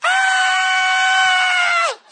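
long high piercing scream; 1 kids' scream. Retold or imitated. Five hundred kids proving their love, a high scream. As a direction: scream at the top of your lungs.

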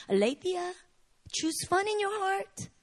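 put-on high, sing-song coaxing of a child; imitating a patient mom; gentle coaxing mother. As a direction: coax a little girl sweetly. Mother voiced coaching small daughter.

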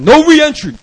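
booming, high-pitched refusal; hammed-up bouncer bellow; bouncer's shout. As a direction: cut him off with a loud shout. Bouncer cuts him off.

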